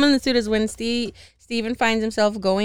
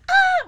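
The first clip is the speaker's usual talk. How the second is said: a short, very high mock scream; imitated scream; 5 dB louder than usual. Retelling the freeze-frame scene, a high mock scream. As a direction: shriek like the frozen Steven. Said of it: "He's like".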